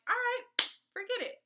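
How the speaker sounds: high, loud all right, forget it; exasperated give-in. Retold or imitated. Caving to the guy's pushing, loud and high. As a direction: throw up your hands and give in. Giving in to the pushy guy.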